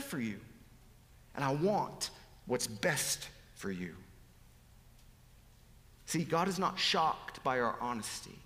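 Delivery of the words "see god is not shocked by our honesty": usual speaking voice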